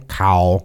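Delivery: "cow" said low in a Thai tone; Thai tone demo. Shown as a demonstration. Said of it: "they might sound the same".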